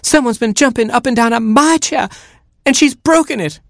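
very high, outraged mother hen; flabbergasted clucking. A narrator's reading. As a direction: cluck it in shrieky disbelief. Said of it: "she clucked, flabbergasted".

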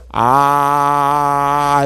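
long, steady held "ah"; open-mouthed waiting. Acted. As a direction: hold an "ah" with mouth wide open. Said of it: "he was waiting like this".

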